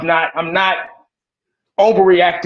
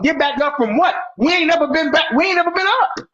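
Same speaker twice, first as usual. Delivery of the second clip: rising indignant rant; building indignation; 8 semitones higher than usual. Real, not acted. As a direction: pitch climbing higher with each line. Heated rant about the Cowboys.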